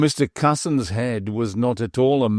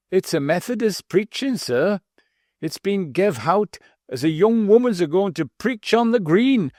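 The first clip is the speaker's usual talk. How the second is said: put-on higher, wheezy voice with a slightly mincing accent; a self-important old butler, pitched up and mincing; treble wheezy butler; 8 semitones higher than usual. A narrator's reading. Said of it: "in a treble and wheezy voice".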